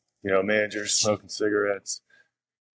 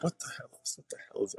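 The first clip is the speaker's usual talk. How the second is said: much softer, under the breath; hushed, half-whispered aside; muttered bewilderment; 4 semitones higher, 11 dB quieter, faster than usual. Retold or imitated. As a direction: mutter it to yourself, retelling your confusion. Retold bewilderment at unknown number.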